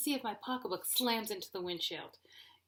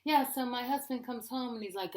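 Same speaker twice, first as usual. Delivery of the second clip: normal talking voice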